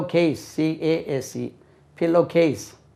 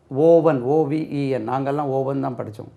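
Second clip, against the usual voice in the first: ordinary delivery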